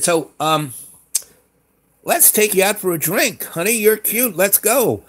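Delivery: pitched well up, sweet-talking her into a drink; high flirty coaxing. Retold or imitated. Narrator sweet-talks the license clerk into a drink, high and flirty.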